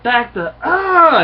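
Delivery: high, loud yell breaking into a scream. Retold or imitated. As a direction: yell it, then cut off in a shriek. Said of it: "she yells out".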